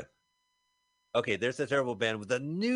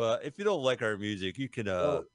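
ordinary delivery

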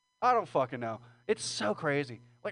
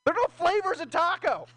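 louder insistence; 8 semitones higher, 4 dB louder than usual. Real, not acted. Louder repeat.

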